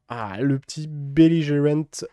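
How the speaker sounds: ordinary delivery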